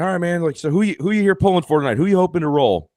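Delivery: bright, high, quick broadcaster hype to a fan; upbeat fan interview. Retold or imitated. Broadcaster revving up a fan before his roll, excited. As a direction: pump the fan up like a live host.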